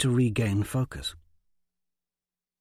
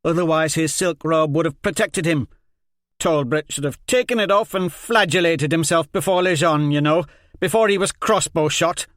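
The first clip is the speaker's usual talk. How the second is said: loud, higher insistent rant; heated argument; 7 semitones higher, 7 dB louder than usual. Acted. Liz Hen argues with Jenko, loud heated insistence. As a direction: press the point angrily.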